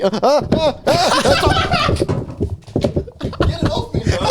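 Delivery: high squealing panic; shuddering revulsion. Real, not acted. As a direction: squeal as it lands on you. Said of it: "That full panic".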